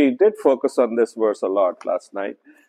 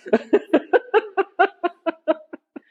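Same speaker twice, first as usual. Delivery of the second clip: amused laugh. Real, not acted. Laughing at blunt quote to God.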